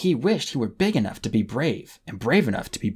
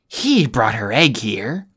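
slow, louder accusing growl; angry snarl; 6 dB louder, slower than usual. A narrator's reading. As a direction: spit out the blame. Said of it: "Kestrel snarled".

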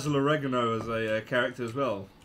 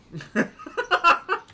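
high, loud burst of laughter; 6 dB louder than usual. Real, not acted. Player whose fly kick failed, loud laughing.

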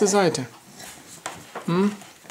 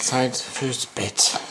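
slow bedtime voice; much slower than usual. Acted.

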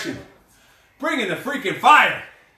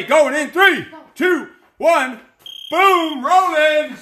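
loud countdown, higher on each number; countdown call; 5 semitones higher, 5 dB louder, much slower than usual. Real, not acted. Coach calling the class in, loud. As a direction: call the start to the whole class.

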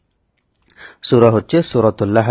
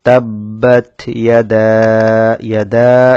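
slow, drawn-out melodic tajweed recitation; slow sung Quranic tilawa; 5 dB louder, much slower than usual. Shown as a demonstration. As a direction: chant each word long and measured. Recites the verse.

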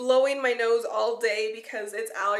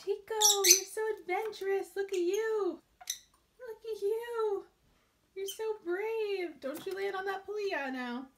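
high, softer coo; 9 semitones higher, 4 dB quieter than usual. Real, not acted. Owner praising her parrot exploring, high soft coo. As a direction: coo up high at the brave bird.

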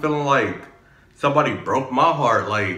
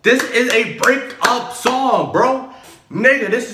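higher, drawn-out hype; slow, high and amazed, like a big discovery; excited outburst; 10 semitones higher, slower than usual. Real, not acted. Reacting to the breakup song for viewers, excited and high.

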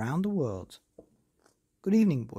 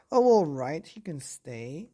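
reluctant giving in; 6 dB louder than usual. Acted. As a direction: give in to the child's begging. Mother gives in.

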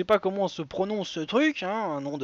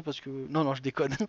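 ordinary talk

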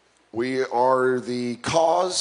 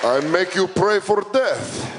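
put-on slow, heavy Russian accent, threatening; menacing Russian tough guy, slow; fake Russian accent; 3 semitones higher than usual. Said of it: "fake a Russian accent".

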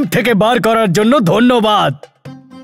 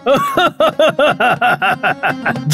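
genie laugh; 3 semitones higher than usual. Acted. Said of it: "একটা Jimmy বাইরে এলো".

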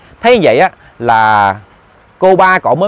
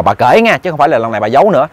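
plain reading voice

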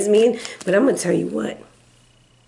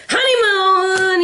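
excited cry; 8 semitones higher, slower than usual. Real, not acted. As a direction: call it out with a thrill. Announcing card with a cry.

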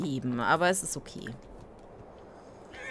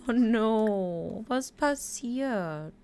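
mock dismay; 4 semitones higher, much slower than usual. Mock concern, consoling Sim.

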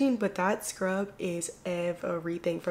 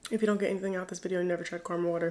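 ordinary talk

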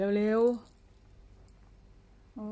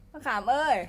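usual speaking voice

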